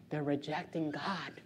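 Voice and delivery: ordinary talk, nothing marked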